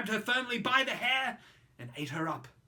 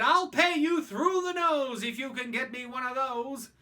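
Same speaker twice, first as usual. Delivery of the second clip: eager rich father; 6 semitones higher, 8 dB louder than usual. Acted. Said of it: "He said".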